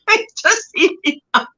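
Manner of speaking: high, giggly repeat; laughing. Real, not acted. Streamer tickled by her own joke, laughing.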